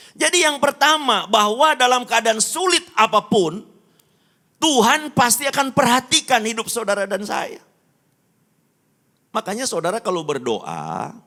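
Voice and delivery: loud, high-pitched sermon delivery; impassioned preaching. Real, not acted. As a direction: preach it at full force, voice lifted high. Preaches at full force. (khotbah berapi-api dengan suara lantang)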